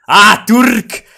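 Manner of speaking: high, loud startled shout; surprised cry. Real, not acted. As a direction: yelp at the sight of Turks.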